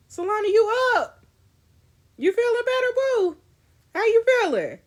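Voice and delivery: high, loud call across the room. Acted. Checking on her injured friend Solana, calling out loudly. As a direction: call out to wake her, bright and carrying.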